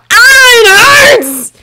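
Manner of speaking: high, loud, slow cry of pain. A narrator's reading. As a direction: wail it as if the pain just woke you. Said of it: "He woke with a shout".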